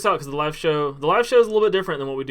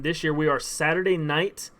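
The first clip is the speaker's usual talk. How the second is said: usual speaking voice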